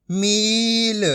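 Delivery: मील with a long held ee; stretched ee in मील; long ī, half final. Shown as a demonstration. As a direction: draw out मील, ending on a light l. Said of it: "आपको आधा करना है".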